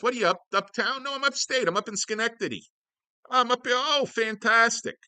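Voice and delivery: high, hammed-up voice; mock upstate bragging. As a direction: play it high and exaggerated. Mock exchange about being upstate.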